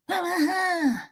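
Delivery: high, drawn-out eerie wail; spooky wordless sound to scare someone; creepy noise. Acted. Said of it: "just making the sounds".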